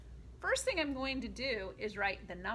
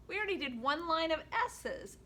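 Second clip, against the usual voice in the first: ordinary delivery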